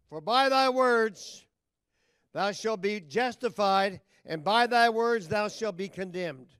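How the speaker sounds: loud, slow, booming scripture reading; preaching scripture. Acted. Preacher thundering the verse at the congregation, slow and loud.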